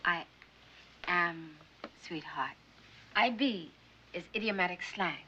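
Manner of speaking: usual speaking voice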